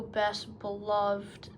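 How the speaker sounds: usual speaking voice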